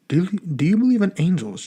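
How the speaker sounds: put-on earnest Russian-accented question. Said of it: "heavy Russian accent".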